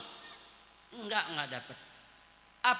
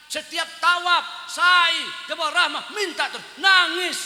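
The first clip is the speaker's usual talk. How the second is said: louder and louder, fervent sermon climax; preaching shout; 4 semitones higher, 6 dB louder than usual. Real, not acted. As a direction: drive it home at full voice.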